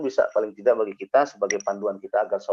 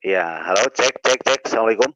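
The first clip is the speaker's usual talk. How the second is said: loud mic check; 5 dB louder than usual. Real, not acted. Mic check on a bad line.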